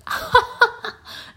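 high-pitched imitated burst of laughter; bursting out laughing. Imitating people laughing at their own downfall, bursting out. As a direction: act out a sudden high cackle. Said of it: "they just start bursting out laughing".

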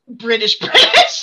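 exaggerated British accent, breaking into laughter; over-the-top British accent. Shown as a demonstration. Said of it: "this is over the top British".

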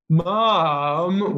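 put-on read-aloud wail of a put-upon little boy; wailing child. A narrator's reading. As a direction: call out "mum" in a stretched whine. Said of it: "wailed Peter".

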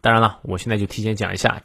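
normal talking voice

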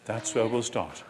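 soft, gentle reassurance from Jesus. Retold or imitated. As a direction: reassure Peter softly. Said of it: "what Jesus is saying is".

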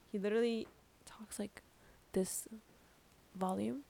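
near-whisper showing how quietly he talks; demoing a professor who barely speaks up; his quiet volume. Shown as a demonstration. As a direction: drop to a breathy hush to show his volume. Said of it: "he talks at this volume".

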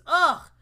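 loud groan of disgust; sharp grossed-out groan; disgusted ugh. Real, not acted. Disgusted reaction to news video.

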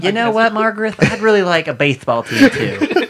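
high-pitched Southern put-on voice; hammed-up Southern local chatting to a friend; Southern accent. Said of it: "I don't know why they have this accent".

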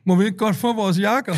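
high, timid request, voice pushed well up; imitating the guy. Guy running after him asking for the jackets back, high and meek. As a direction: ask meekly like a nervous young man. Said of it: "så siger jeg".

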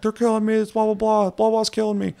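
high-pitched imitation of a distant victim screaming; mock scream. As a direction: wail for help in a put-on high voice. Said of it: "out in the woods screaming".

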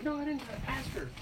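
high-pitched mock innocence; high defensive protest. Thirteen-year-old denying to grandfather, high and defensive. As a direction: squeak it out defensively. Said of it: "I'm like".

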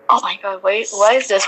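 ordinary talk, nothing marked

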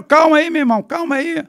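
urgent calling out. Retold or imitated. Driver pleads with the shooters to calm down, urgent shout. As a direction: shout for them to hold off. Reencena grito aos atiradores.

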